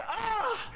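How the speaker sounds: very high-pitched comic scream; mock shocked scream.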